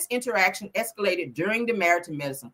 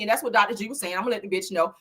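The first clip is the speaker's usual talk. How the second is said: ordinary delivery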